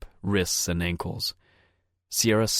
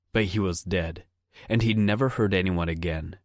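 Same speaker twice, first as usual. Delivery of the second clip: ordinary delivery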